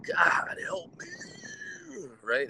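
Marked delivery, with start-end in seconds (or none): 0.0-1.1 breathy whispered plea to God, higher; a drowning man whispering for help; imitated desperate plea.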